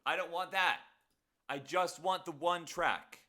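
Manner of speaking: sped-up high squeaky voice; chipmunk voice. Real, not acted. Sped-up playback of own voice.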